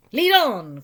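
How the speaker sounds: loud, eager call; eager cry. A narrator's reading. Rat follows the duck, loud eager cry. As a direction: urge her on greedily. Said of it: "cried the rat".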